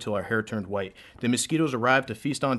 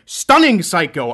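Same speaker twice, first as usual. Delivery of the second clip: shout; 7 semitones higher, 12 dB louder, slower than usual. Acted. Climax of insult line.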